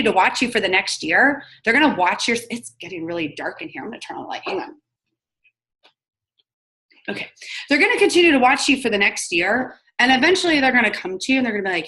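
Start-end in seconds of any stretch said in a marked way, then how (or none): none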